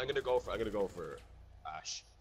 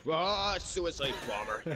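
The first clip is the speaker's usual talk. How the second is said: drawn-out groan; 6 semitones higher, much slower than usual. Real, not acted. Dismayed drawn-out call.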